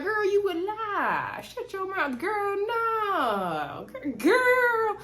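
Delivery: high mock-shocked girlfriend voice, slow; high girlish disbelief; Kimmy's reply. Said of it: "Kimmy's like".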